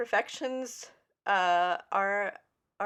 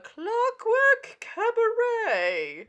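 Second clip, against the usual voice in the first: show announcer; 6 semitones higher, 5 dB louder than usual. Acted. Host opens the Clockwork Cabaret, grand announcer. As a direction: announce it grandly, stretching the final words.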